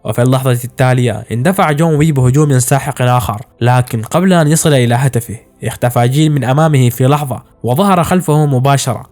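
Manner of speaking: usual speaking voice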